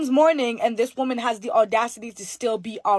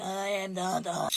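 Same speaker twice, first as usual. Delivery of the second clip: much softer and lower, a groaning spirit voice; possessed moaning spirit; 5 semitones lower, 10 dB quieter than usual. Retold or imitated. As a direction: let a spirit moan the words out of her. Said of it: "she's moaning and groaning like".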